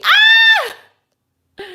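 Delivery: a loud, very high startled yelp; sudden high shout. Real, not acted. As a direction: let out a sharp high cry.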